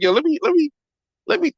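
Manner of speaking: sudden high, excited stammer; eager to butt in. Retold or imitated. Streamer replays himself jumping into a live, excited and high. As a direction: rush in high, eager to cut in. Retelling his eagerness to chime in.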